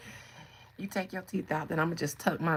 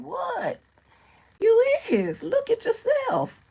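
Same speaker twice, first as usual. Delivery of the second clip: put-on much higher, louder innocent old-lady squawk; shady old lady; 7 dB louder, slower than usual. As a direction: play dumb, loud and shrill-pitched. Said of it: "And then saying".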